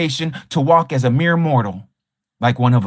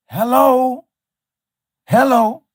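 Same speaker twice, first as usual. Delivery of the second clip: sarcastic hello; 6 semitones higher, slower than usual. Acted. Sarcastic calling out.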